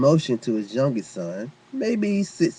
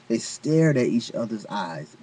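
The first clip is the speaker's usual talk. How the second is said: plain reading voice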